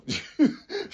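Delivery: soft high-pitched chuckle; quiet little laugh to himself. Real, not acted. Admitting he was chasing a girl, soft chuckle.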